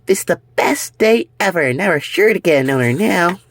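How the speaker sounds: high, bubbly cartoon voice; excited. Fluffy thrilled about finding an owner, high and bubbly. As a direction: squeal with joy.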